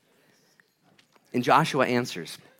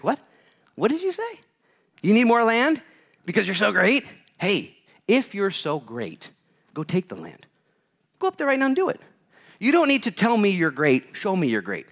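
tough Joshua; 8 semitones higher than usual. Acted. Preacher voices Joshua rebuking complaining tribes, tough. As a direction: scold them like a dad calling a bluff. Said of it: "the toughness of Joshua".

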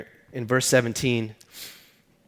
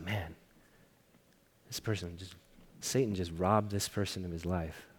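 much softer, lower, almost to himself; hushed inner thought; 4 semitones lower, 12 dB quieter than usual. Retold or imitated. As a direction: drop the voice to a private thought.

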